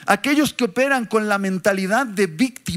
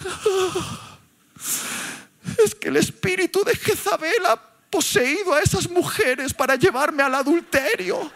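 whining excuse; 6 semitones higher than usual. Retold or imitated. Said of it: "Y dijo".

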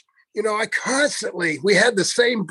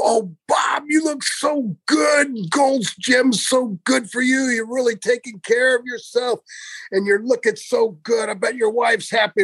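gushing Brian; 4 semitones higher than usual. Retold or imitated. Friend imitating Brian flattering a gym member, high gushing voice. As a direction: lay the flattery on thick. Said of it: "he'd go over to him and go".

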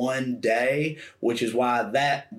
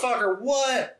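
much higher stunned exclamation; mock disbelief; shocked; 10 semitones higher than usual. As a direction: yelp of surprise. Stunned reaction retold.

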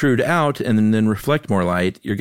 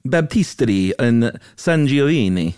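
high, slow mock-Italian delivery; showy Italian accent; 6 semitones higher, 4 dB louder than usual. Host steps in to pronounce it for co-host, showy Italian accent. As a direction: draw it out with a theatrical Italian accent. Takes over Italian words on request.

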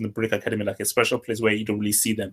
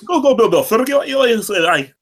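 put-on high, loud jokey Scottish accent; mock Scottish accent; 11 semitones higher, 5 dB louder than usual. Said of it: "that's exactly what Scottish sounds like to me".

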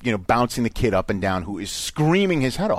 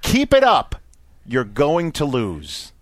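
imitated threat; slower than usual. Angry husband warning a dad, menacing. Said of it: "he responds thusly".

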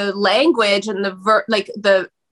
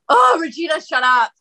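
exasperated retort; 6 semitones higher than usual. Retold or imitated. Snapping back at inner voice.

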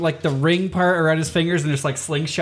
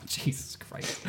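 breathless laugh; 8 dB quieter, much slower than usual. Real, not acted.

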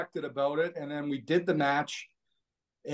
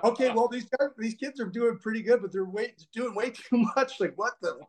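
imitating the backstage crowd, pitched higher; a higher voice, half-amused and half-alarmed at the kids; mocking backstage wrestlers; 6 semitones higher than usual. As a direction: backstage wrestlers reacting to the kids' risky match. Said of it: "everyone's going".

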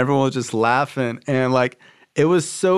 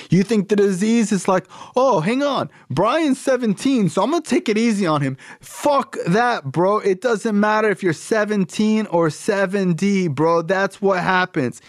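high, emphatic imitation of a sponsor; impassioned scolding; 7 semitones higher than usual. Sponsor telling caller the disease ignores age, impassioned scolding. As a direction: lecture hard in a raised pitch. Sponsor rants in retold phone call.

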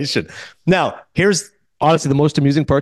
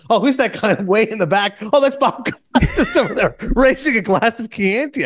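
words breaking into real laughter, higher at the end; laughing through it; 6 semitones higher than usual. Real, not acted. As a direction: giggle while describing the photo. Amused at the photo.

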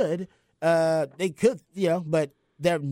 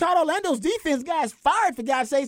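fired up; 10 semitones higher than usual. Real, not acted. Passionate defense of Texas.

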